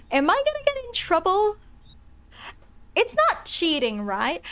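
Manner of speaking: high, worried pitch jump; worried squeak. Real, not acted. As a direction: squeak it anxiously. Worried about game endings.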